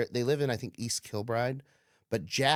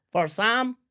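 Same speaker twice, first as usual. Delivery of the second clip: lightly put-on foreign driver voice, raised pitch; higher, questioning rideshare driver bit; voiced driver; 9 semitones higher, 4 dB louder than usual. Comic driver pulling up to pick someone up, accented.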